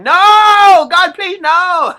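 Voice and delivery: high, loud anguished No; howling no in mock despair; yelling like Michael Scott. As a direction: scream it like Michael from The Office. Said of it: "Michael from The Office yelling".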